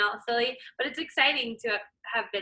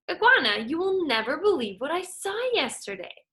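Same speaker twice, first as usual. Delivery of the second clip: a lightly voiced, higher, excited mosquito; eager mosquito; 6 semitones higher than usual. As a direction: chatter like a gossipy little bug. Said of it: "The mosquito said".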